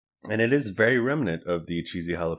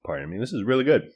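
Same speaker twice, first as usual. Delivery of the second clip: talking with a mouthful; mouth full; 3 semitones higher, 3 dB louder than usual. Real, not acted. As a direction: speak around a bite of food. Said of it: "Sorry for talking with my mouth full there".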